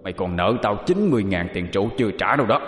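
indignant spirit in an altered voice; possessed dead man. A narrator's reading. Dead uncle rebuking his forgetful nephew, indignant ghostly voice. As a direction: gripe at the nephew in a put-on dead man's voice. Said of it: "đang trong cơn giận dữ".

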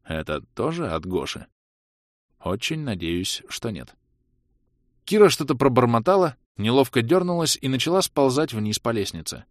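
ordinary delivery, nothing marked

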